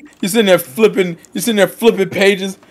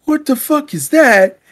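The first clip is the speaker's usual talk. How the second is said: mock startled Ash; 6 semitones higher, slower than usual. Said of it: "he's like".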